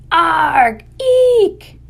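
loud high squawk, then piercing squeak; squirrel battle cry. Acted. Squirrel facing the shovel, loud shrieking cry.

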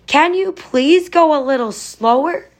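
high, drawn-out pleading call; narrated shout. A narrator's reading. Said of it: "Oscar shouted".

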